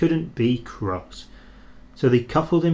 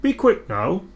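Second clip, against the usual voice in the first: quick high call to hurry; brisk urging; 7 semitones higher than usual. Acted. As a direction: urge the engine along. Narrator voicing a character.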